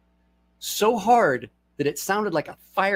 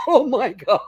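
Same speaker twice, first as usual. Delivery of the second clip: laughing shock; 10 semitones higher, 7 dB louder than usual. Retold or imitated. Retold reaction between laughs.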